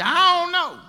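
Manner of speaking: high, loud teasing; mock shrug. Elijah mocking the prophets of Baal loudly. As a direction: tease them with a shrug in the voice.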